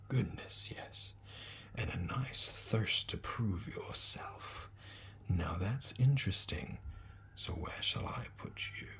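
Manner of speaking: slow, soft, musing whisper; an old hat thinking aloud; sorting hat whisper. Acted. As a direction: weigh him up in a hush, right in his ear. Sorting hat speaks in ear.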